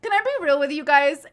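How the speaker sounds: loud, high, through laughter; real laughter running into the words; laughing hard. Real, not acted. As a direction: crack up while agreeing. Laughing at viewer's comment.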